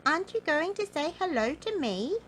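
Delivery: high-pitched little goblin asking. A narrator's reading. Goblin questioning rude Mister Uppity, in a high little voice. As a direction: ask in a high, lilting storybook goblin voice. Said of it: "asked the goblin".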